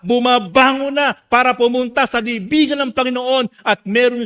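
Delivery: high, loud, driving sermon delivery; impassioned pulpit shouting on a sustained high pitch; full-force preaching. Real, not acted. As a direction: preach it at the top of your voice, relentlessly.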